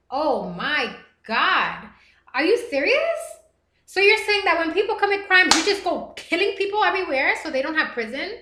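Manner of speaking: high, loud incredulous questions; can't believe what she just read; shocked disbelief. Real, not acted. Streamer reacting to a viewer's comment, shocked and loud.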